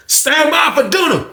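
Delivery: high, drawn-out call; slow high-pitched holler to the whole unit; imitating an institutional dinner call; calling out an announcement.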